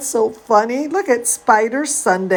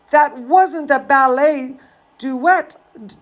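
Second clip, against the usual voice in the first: plain reading voice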